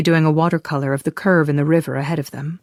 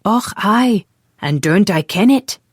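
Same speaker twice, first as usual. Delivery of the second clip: high mock Scottish Och, aye; a playful hint of Scots from a slow-witted lad; imitated Scottish accent; 5 semitones higher, 4 dB louder, slower than usual. Tim parroting Alex with a mock Scottish accent. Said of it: "Tim had started saying".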